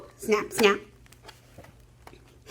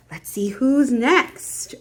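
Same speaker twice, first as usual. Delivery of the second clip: usual speaking voice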